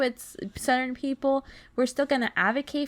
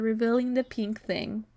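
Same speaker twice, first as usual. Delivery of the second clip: ordinary delivery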